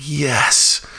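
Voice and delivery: happy little yes; toddler's eager yes. Retold or imitated. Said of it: "And she's like".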